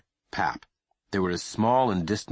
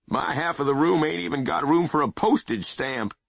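put-on grumpy old man; 8 semitones higher than usual. Pap voiced by reader.

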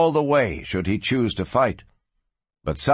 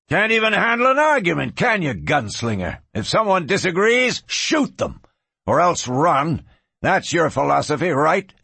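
high-pitched, heated goading; angry jeering; 6 semitones higher than usual. Acted. Rancher jeers at the departing gunslinger, angry taunting. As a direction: goad him into turning back.

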